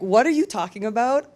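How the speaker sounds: ordinary delivery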